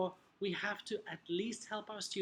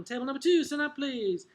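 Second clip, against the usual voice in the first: high, loud classroom command; calling on pupils; 5 semitones higher, 5 dB louder than usual. Acted. Teacher calling table two to stand, calling out. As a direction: call the next team up briskly.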